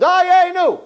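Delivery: high, full-voiced cry; screamed chorus. Each person answering through the whole song, high scream. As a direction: yell the refrain way up high. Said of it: "each one, everyone's screaming".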